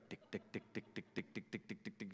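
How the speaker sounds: soft unvoiced clicking tick, tick, tick; coaster clicking. Shown as a demonstration. Imitating the coaster climbing the lift hill, soft clicking. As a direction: tick like the chain pulling the coaster uphill. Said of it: "start going tick".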